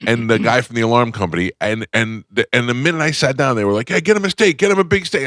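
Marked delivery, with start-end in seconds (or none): 3.9-5.3 mock wiseguy host. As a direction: boss the waiter around for the guest. Imitating Sopranos guys ordering food.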